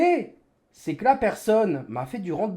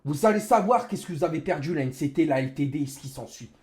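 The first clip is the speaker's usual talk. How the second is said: ordinary talk, nothing marked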